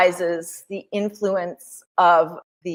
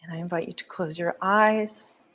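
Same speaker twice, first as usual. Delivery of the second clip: guided meditation; 4 semitones lower than usual. Acted. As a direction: ease them into stillness, gently.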